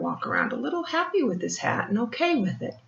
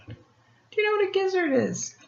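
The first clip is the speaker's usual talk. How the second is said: ordinary delivery, nothing marked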